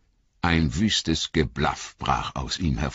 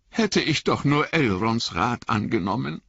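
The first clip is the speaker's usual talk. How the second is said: ordinary delivery, nothing marked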